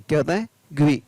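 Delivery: ordinary talk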